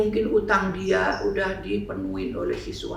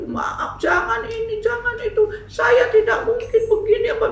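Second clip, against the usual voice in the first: high-pitched, shouted begging, half-laughing; crying plea; 14 semitones higher, 3 dB louder than usual. Retold or imitated. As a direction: beg him through tears, much higher. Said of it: "Dia nangis". (perempuan memohon sambil menangis, suara tinggi)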